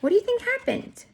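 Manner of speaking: high, playful question; reading to children. Acted. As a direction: tease the kids with suspense.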